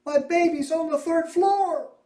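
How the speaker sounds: high, loud frantic cry; screaming mother. A narrator's reading. Mother screams to the firemen about her baby, frantic high cry. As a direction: scream it in terror for the child.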